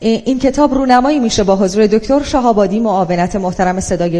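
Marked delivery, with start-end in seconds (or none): none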